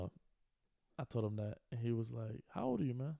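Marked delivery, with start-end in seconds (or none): 2.5-3.2 higher-pitched, casual friendly question; making genuine conversation. Retold or imitated. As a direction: ask it like honest small talk, pitched up. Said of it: "trying to make conversation, like, in genuine conversation".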